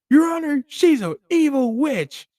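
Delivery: high, loud, whiny accusation; angry spouse in court. Acted. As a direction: complain bitterly to the judge. Divorce court complaint acted.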